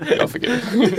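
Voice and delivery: laughing absolution. Pretend. Mocking a drive-through confession, laughing. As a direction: giggle out the pardon. Laughing mock priest line.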